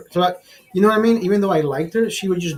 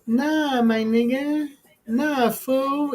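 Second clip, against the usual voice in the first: high, drawn-out mock girl voice; imitated slangy girl; 6 semitones higher, much slower than usual. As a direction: stretch it out high, mimicking her slang. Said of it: "when they follow with".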